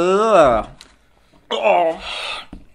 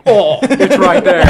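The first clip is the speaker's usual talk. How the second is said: pained laughing; 3 semitones higher, 4 dB louder, slower than usual. Real, not acted. Pepper stuck in throat.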